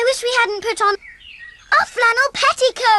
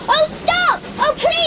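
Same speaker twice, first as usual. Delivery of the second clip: desperate plea; 7 semitones higher, slower than usual. Acted. Waving flags at oncoming train.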